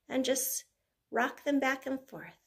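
normal talking voice

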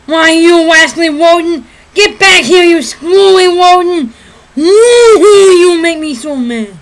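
loud, exasperated cartoon hunter; Elmer Fudd. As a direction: turn R's into W's in a worked-up cartoon rant. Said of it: "Elmer Fudd".

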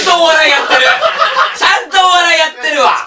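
laughing shout. Real, not acted. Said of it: "ちゃんとじゃない".

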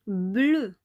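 bleu, the eu said clearly and firmly; teaching the eu sound. Shown as a demonstration. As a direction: model bleu for the class. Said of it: "ça donne le son E".

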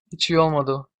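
usual speaking voice

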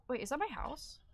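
normal talking voice

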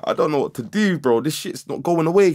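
high-pitched, helpless retold complaint; exasperated distress. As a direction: act out being at a loss. Acting out panic at unshakable thoughts.

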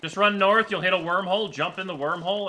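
urgent directions. Real, not acted.

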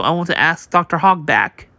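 plain reading voice

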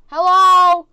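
high, very loud hello; calling out. Pretend. Visitor calling out to dinosaurs, loud call. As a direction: holler a drawn-out greeting.

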